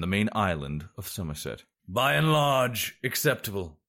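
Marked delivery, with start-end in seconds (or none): 1.9-3.8 raised, drawn-out pronouncement; proclaiming. A narrator's reading. Sorcerer to his students as the last fruit drops, proclaiming. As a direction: proclaim it slowly to the class. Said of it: "he proclaimed".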